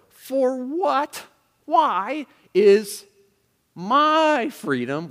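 high, loud, slow, with "my" stretched out; indignant emphasis on MY. Acted. Preacher voicing the Corinthians' attitude, indignant stress on "my". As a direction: challenge them, leaning long and hard on "my". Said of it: "where's the emphasis in this attitude".

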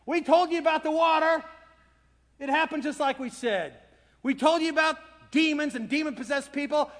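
high, insistent grumbling; told-you-so disciples. Acted. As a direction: complain in a repeated sing-song protest, building. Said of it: "And they're saying".

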